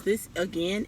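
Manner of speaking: plain reading voice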